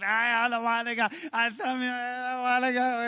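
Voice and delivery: mimicked chorus of whining kids; whining nasal twang. Kids complaining in the back seat, mock nasal whining. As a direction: whine through the nose like a pestering child. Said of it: "whining nasal twang chorus".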